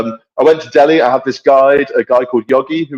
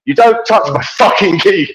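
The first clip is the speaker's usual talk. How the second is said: raised-pitch retold warning; retold angry warning; 6 semitones higher than usual. Rider warns the man who took his keys, retold angrily. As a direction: firmly tell him off, as retold.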